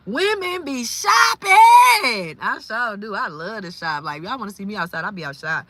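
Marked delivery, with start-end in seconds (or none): 0.1-2.4 high, slow, shouted punchline; comedic quote. Retold or imitated. Quoting the comedian's joke, loud and drawn out. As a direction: belt it like a comedian's catchphrase. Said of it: "'Cause what he say".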